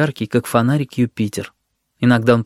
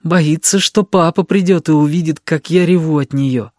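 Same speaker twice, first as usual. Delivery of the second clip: put-on higher, spiteful boyish thought; vengeful; 4 semitones higher than usual. A narrator's reading. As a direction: think it with a touch of spite. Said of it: "мстительно подумал". (мстительно, с детской обидой)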